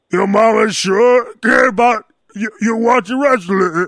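slow, loud giant voice; Andre the Giant voice. Retold or imitated. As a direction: boom it like a giant. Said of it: "Andre the Giant walking out".

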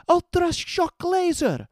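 high, loud attack shout; battle cry. A narrator's reading. Heroine fires her electric gun, battle cry. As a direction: call out the attack, drawn out.

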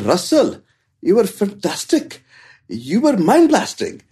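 put-on high, excited Indian accent, drawn out on mind blasting; imitated Indian accent. Indian fan gushing to comedian Russell Peters, in a high imitated Indian accent.

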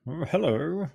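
playful mock-governor hello; Lew Wallace. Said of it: "That was my Lew Wallace impersonation".

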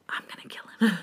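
whispered threat full of rage. Acted. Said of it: "feels rage welling up".

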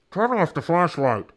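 loud, high shout from inside a helmet; boxed-in, urgent yell; echoing inside metal helmet. A narrator's reading. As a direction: shout as if your head is stuck in a metal pot. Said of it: "Jack's voice echoed inside the metal helmet".

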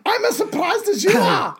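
very high comic squeal; hammed-up astonished cry; mock surprise. Conqueror shares surprise at the quick victory, absurdly astonished. Continues the shouted comic bit.

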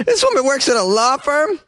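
high incredulous squeal; disbelief. Real, not acted. Host reacting to the news in high disbelief. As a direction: sound shocked she has that job.

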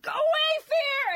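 very high, loud shriek; panicked mock scream. Hungover woman yelling at Fear in the corner, mock-terrified scream. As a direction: scream it to chase off a bogeyman, hammed up.

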